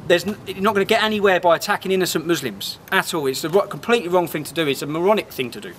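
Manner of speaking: ordinary delivery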